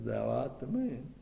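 low, soft grave accusation; God quietly naming the guilty; solemn divine verdict. Acted. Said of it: "And God says".

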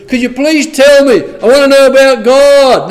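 high, loud, hammed-up begging; over-the-top pretend pleading; mock eager plea. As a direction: plead desperately as an imaginary eager seeker, exaggerated. Mocks imagined stranger begging to hear.

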